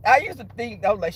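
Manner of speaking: ordinary delivery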